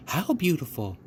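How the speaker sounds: put-on eager child. A narrator's reading.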